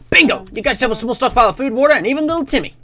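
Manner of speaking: high, fast American announcer; hammed-up Cold War American voice; American accent. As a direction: cheer it like American propaganda. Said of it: "like, an American voice".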